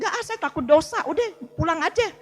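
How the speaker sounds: laughing through a retold no; laughing refusal. Telling her friend no, she fears sin, laughing.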